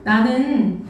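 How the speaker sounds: normal talking voice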